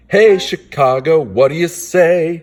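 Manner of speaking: high, loud happy cheer; excited kids. Acted. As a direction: call out like thrilled kids.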